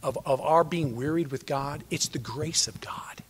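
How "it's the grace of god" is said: slow awed whisper. Real, not acted. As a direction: breathe it out softly. Preacher whispers key point.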